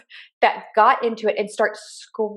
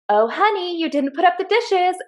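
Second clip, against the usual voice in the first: mock nagging spouse; 7 semitones higher than usual. Acting out a marital nag.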